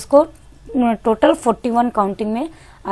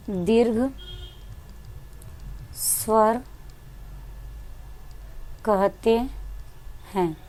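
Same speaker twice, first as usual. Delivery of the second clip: softer, long pauses between each word, drawn-out ending; slow dictation; 3 semitones lower, 9 dB quieter, much slower than usual. Shown as a demonstration. Teacher dictating the long-vowel definition to students while writing. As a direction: read it out slowly as you write it. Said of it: "देखिए मैं definition यहीं right कर दे रही हूँ".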